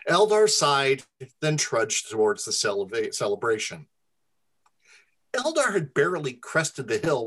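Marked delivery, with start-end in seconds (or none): none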